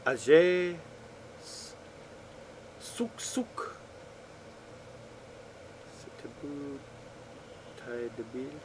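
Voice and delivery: words read in a mock French accent; lightly frenchify every syllable. Shown as a demonstration. Mock French reading of a friend's Czech sentence. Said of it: "I'm saying it like it's French".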